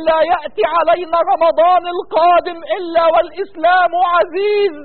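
fervent, drawn-out Arabic du'a; impassioned supplication. Real, not acted. Imam leading prayer for suffering brothers, fervent pleading. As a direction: plead with God, stretching the ends of phrases.

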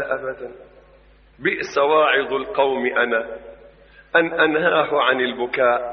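put-on low, soft, sorrowful quoting of the old preacher; soft low quoted voice. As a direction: speak it low and grieving. (صوت منخفض حزين)

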